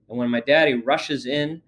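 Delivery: ordinary delivery, nothing marked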